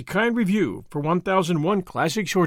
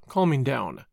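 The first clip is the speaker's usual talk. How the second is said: usual speaking voice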